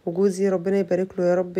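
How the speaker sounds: ordinary talk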